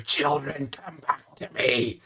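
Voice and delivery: lightly voiced dragon goddess, slow on the last call; a hint of a monstrous mother calling her brood; dragon queen calling. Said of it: "just roaring heads, going".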